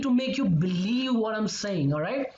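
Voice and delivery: ordinary delivery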